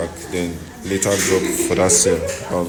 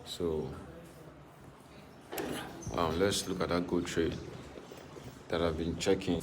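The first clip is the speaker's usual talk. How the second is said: hushed, softer voice in a hospital; in a hospital corridor; 12 dB quieter than usual. Real, not acted. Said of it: "I'm actually at, at the hospital right now".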